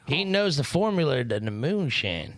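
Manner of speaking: hammed-up country hillbilly twang; hillbilly accent. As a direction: play a backwoods hillbilly yokel. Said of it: "Fucking hillbilly seance over here".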